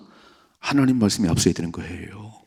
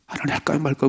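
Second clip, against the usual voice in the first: David asking God; 6 semitones higher than usual. Retold or imitated. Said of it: "그렇게 물었던 다윗이요".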